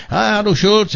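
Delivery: high-pitched mock German radio host; fake German accent. Radio man imitating a German show host, fake German accent. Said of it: "did a great fake German accent".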